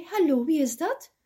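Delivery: "hallo" hoger en luider als vraag, met stijgende toon; roepend aan de telefoon. Acted. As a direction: vraag "hallo" hoog en luid, toon omhoog. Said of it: "riep zij". (Roodkapje neemt de telefoon op, hoog en vragend)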